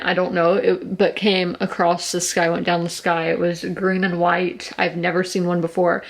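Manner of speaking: ordinary talk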